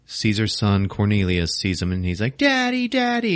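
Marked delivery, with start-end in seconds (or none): 2.4-3.4 high-pitched mock child voice; little son calling. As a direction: call out to Dad in a tiny high voice. Young son calls to father.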